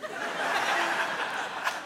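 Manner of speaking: silent wheezing laugh; real helpless giggle; high breathless laugh. Real, not acted. Laughs at his own story.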